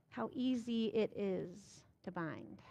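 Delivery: usual speaking voice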